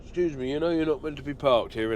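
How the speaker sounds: imitating a Welsh security guard; Welsh accent. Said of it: "in a Welsh accent".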